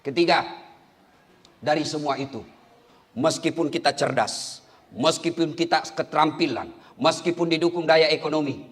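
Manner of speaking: usual speaking voice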